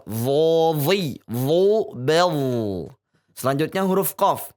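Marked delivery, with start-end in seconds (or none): 0.0-2.3 "Dho, dhi, dho, bel" with a heavy, thick dho, each syllable held and loud; heavy emphatic dho chant for students; thick dho drill. Shown as a demonstration. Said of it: "jauh lebih tebal".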